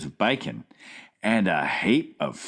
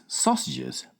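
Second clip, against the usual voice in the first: plain reading voice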